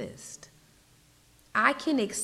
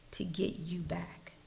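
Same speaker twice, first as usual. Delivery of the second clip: soft unvoiced whisper; whispered promise; 2 semitones lower, 6 dB quieter than usual. Preacher voicing God's promise to the listener, whispered. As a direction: let the promise fall to a whisper.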